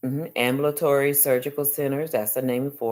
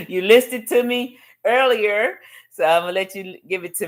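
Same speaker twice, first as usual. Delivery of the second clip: laughing; 6 semitones higher, 4 dB louder than usual. Real, not acted. Teasing Jane, still laughing.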